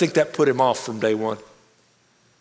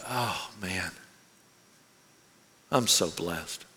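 soft low whisper-quiet awe; quiet moved thought; 7 semitones lower, 10 dB quieter, much slower than usual. Real, not acted. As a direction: hush it as a grateful inner thought. Moved by friend's earnest pride.

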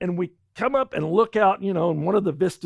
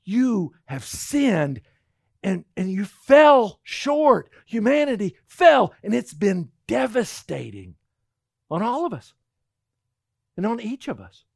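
slow, forceful and louder; Paul insisting; 2 semitones higher, 4 dB louder, slower than usual. Retold or imitated. Voicing Paul to the congregation, slow and forceful. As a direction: thunder it like an apostle. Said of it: "Paul goes".

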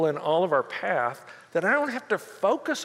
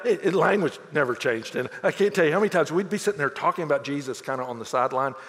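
ordinary talk

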